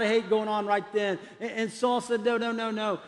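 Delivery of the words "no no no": ordinary delivery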